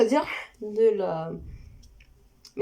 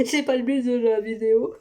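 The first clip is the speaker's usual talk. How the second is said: laughing. Real, not acted. Laughing off a tangent.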